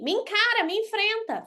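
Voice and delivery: ordinary talk, nothing marked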